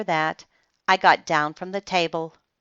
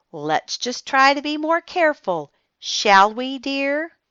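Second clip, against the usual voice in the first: higher, mannered stern-sweet grandma; prim grandmother; 7 semitones higher than usual. Acted. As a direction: correct the child politely through tight lips. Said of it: "she said".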